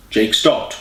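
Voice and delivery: plain reading voice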